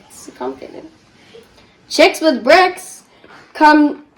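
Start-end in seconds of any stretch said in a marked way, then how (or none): 0.1-0.9 breathy whispered aside; a hushed complaint about the book. Real, not acted. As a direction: whisper it to yourself.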